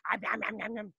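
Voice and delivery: playful eating noises; munch it like a cartoon; silly nom nom. Shown as a demonstration. Teacher being silly for a kid, playful nom noises. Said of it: "Be silly".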